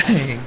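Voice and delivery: sudden booming bang; loud bang sound effect. Retold or imitated. Sudden hit.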